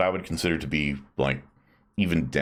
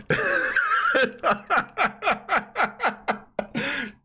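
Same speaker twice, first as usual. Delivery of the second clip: high burst of laughter; big laugh. Real, not acted. Laughs at the guess.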